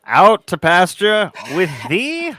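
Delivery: slow, grand mock proclamation; mock old English decree. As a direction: pronounce it like a royal decree. Mock banishing a hated defendant.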